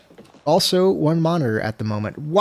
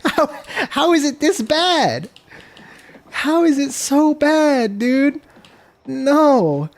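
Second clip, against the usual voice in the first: exasperated; 12 semitones higher, slower than usual. Real, not acted. Frame rate furious.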